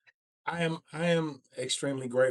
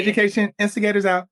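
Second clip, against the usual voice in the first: high, loud, fast show outro; upbeat podcast host closing the show; hyped sign-off; 10 semitones higher, 7 dB louder, faster than usual. Acted. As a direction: announce the sign-off with a radio flourish.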